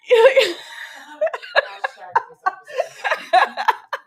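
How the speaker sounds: high, long helpless laugh; burst of laughter. Real, not acted. Cohost hears the stranger-in-car part and bursts out laughing. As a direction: cracks up at her friend's story. Outburst at risky stranger story.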